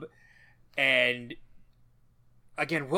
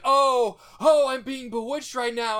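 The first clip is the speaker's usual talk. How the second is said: higher, louder theatrical wailing; fake bewitched girl; 7 semitones higher, 5 dB louder than usual. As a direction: wail like a possessed girl. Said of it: "she acts like".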